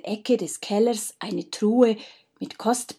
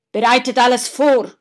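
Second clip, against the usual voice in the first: loud, higher barked order; commanding order; 4 semitones higher, 8 dB louder than usual. Acted. Faustus ordering his brothers to prepare, loud command. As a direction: order your brothers to get ready. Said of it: "befahl Faustus".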